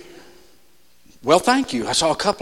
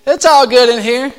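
higher, put-on grinning voice; fake smiley cheer; 5 semitones higher, 4 dB louder than usual. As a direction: paste a smile over the pain. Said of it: "put a smile on it. Smiley face".

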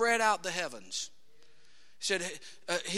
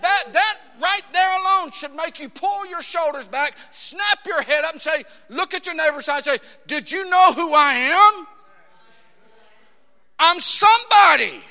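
loud, high exhortation; preacher shouting self-worth; preaching at full force; 7 semitones higher, 11 dB louder than usual. Acted. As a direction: lift them up, shout it out. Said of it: "preaching at full force".

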